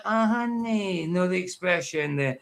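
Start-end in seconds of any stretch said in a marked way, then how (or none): 0.0-1.1 "Oh, honey." stretched slow and syrupy; cringy drawn-out "honey". Pretend. Mocking the video, slow exaggerated "Oh, honey.". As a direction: draw out "Oh, honey." like a cringy sweet talker. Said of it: "but no, like".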